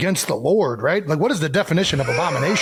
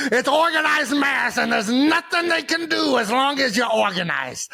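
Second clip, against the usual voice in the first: hammed-up Scottish accent; Scottish impression; 8 semitones higher than usual. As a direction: put on a big Scottish coach voice, pitched way up. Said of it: "do my best Scottish impression".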